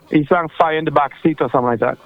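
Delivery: usual speaking voice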